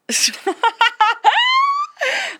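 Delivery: high, loud burst of laughter; laughing fit. Real, not acted. Cracking up at co-host starting the plug, shrieking laughter.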